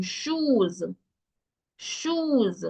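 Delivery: shoes with a stretched long oo; long oo sound. Shown as a demonstration. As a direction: draw out the oo in shoes. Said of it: "a longer oo sound".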